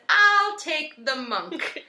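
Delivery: slow deadpan Ragnar. Retold or imitated. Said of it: "and he says".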